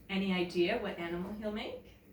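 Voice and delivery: ordinary delivery, nothing marked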